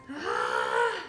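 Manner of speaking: plain reading voice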